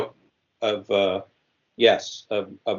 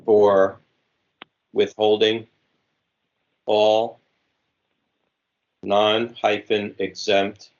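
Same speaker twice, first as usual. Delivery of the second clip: dictating. Shown as a demonstration. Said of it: "Okay, I am ready again".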